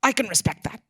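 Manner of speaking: mock-British pronouncement; Harold Pinter's British accent. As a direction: grant it grandly in a British accent. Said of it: "And he said".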